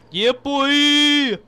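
loud, high hype yell; excited shout. Real, not acted. Excited call at the game.

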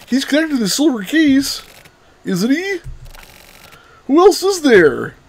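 high, sing-song character voice; her character voice. Acted. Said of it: "I was trying to do her voice".

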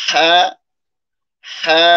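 হা held, breathed from the middle of the throat. Shown as a demonstration. Teacher gives the articulation point, হা held from the middle throat. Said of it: "হা উচ্চারণের মাখরাজ হলো কণ্ঠনালীর".

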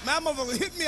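normal talking voice

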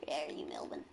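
hushed unvoiced whisper. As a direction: whisper while sneaking up. Looking for Melvin.

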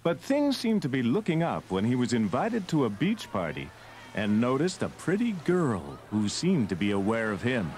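ordinary delivery

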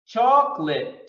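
chocolate as two beats, CHOC-lit; firm, clear English "chocolate" with no middle vowel; two syllables. Shown as a demonstration. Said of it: "two syllables".

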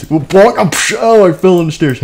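high, loud pretend mishap; mock cry of pain; cartoon tumble. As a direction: act out a clumsy fall with sound effects. Acts fight and fall on stairs.

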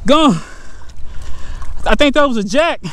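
loud, high cries while fighting a fish; excited shouting. Real, not acted. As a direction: call out urgently mid-struggle. Fighting fish, then losing it.

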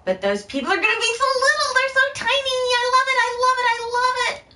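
very high squeaky cooing voice; cutesy squeal. Real, not acted. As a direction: coo at them like tiny babies. Cooing over tiny game characters.

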